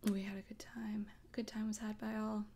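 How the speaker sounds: hushed whisper to close the story. Pretend. Host whispering a cozy aside to listeners. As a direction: breathe the line out softly.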